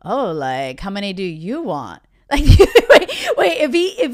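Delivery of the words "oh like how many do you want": low mock player, agreeing too easily. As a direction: play a bullshitting guy, sinking lower as he goes. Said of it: "from the player's perspective".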